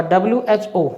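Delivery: डब्ल्यू एच ओ said as three separate letters. Shown as a demonstration. Teacher showing students डब्ल्यू एच ओ said letter by letter. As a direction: spell out डब्ल्यू एच ओ, each letter apart. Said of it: "एक एक letter बोल दो उसका".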